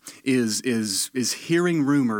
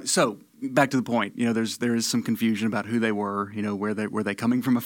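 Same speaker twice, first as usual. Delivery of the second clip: usual speaking voice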